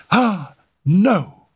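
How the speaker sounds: high, alarmed outcry; distressed refusal. Acted. Simone springs up refusing to sit, distressed. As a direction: recoil and refuse sharply.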